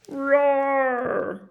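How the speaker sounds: ordinary delivery, nothing marked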